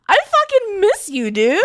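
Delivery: high, loud, excited affection; gushing reunion. Real, not acted. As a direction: gush loudly at a friend you've missed.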